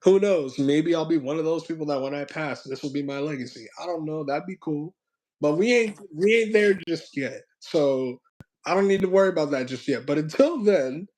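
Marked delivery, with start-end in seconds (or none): none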